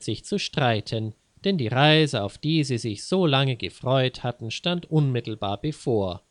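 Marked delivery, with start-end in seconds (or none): none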